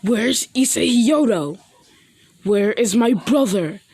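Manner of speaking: loud, demanding acted shout. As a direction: demand an answer at full voice. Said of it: "He s-, s-, shouts".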